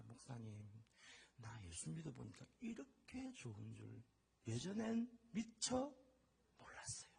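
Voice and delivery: very soft, halting retold testimony; quiet, slowing, trailing into near-whisper; imitated humble confession. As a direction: confide it to the pastor, hushed. Retells husband's confession to pastor.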